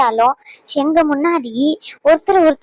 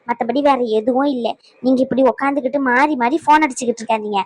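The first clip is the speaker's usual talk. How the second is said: normal talking voice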